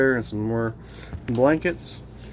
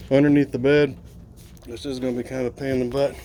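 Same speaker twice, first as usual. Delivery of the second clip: usual speaking voice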